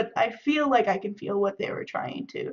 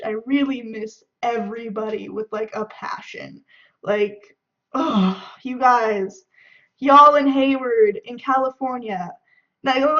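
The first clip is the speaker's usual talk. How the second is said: normal talking voice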